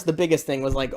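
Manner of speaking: ordinary talk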